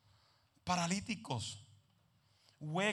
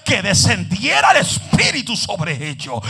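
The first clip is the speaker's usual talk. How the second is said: much louder, slower shout; preaching shout; 2 semitones higher, 18 dB louder, slower than usual. Acted. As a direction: drive the line home to the crowd. Preaching climax.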